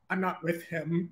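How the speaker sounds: quietly distance yourself from the troublemaker; muttered disavowal. Acted. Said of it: "Chucky is like".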